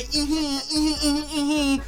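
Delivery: soft string of high giggles; giggling. Acted. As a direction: giggle quietly after the put-down. Giggles after the Activision bit.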